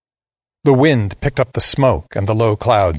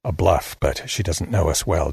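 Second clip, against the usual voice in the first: plain reading voice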